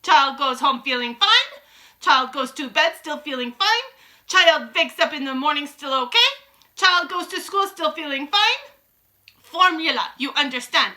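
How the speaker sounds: high, loud Grand High Witch voice; gloating witch. A narrator's reading. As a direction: proclaim it like a scheming witch. Villain's speech in a story.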